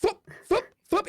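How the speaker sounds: high whooshing sound effects; high foop foop of flying knives; knife whooshes. Retold or imitated. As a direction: throw out whoosh noises. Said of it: "He's like".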